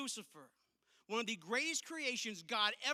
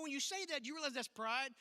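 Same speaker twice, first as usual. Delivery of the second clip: ordinary delivery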